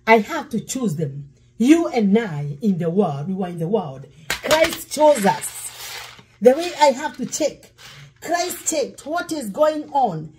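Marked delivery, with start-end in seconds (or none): none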